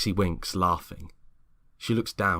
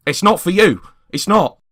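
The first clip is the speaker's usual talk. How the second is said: much higher, louder snapping refusal; sharp outburst; 13 semitones higher, 14 dB louder than usual. Acted. James stopping Greg eating the biscuit, loud and sharp. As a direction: bark it to stop him eating.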